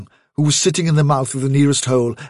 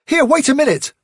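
much higher urgent shout to halt; urgent call; 12 semitones higher, 3 dB louder than usual. Acted. Hazel to rabbits running for cover, urgent call. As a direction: call out sharply to stop them.

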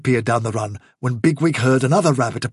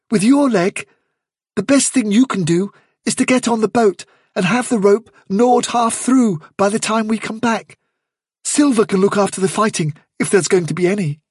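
higher-pitched, insistent character voice; earnest rabbit adviser; 8 semitones higher than usual. Acted. Fiver telling lame Hazel to mind the boat, insistent. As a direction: pitch it up and press the advice.

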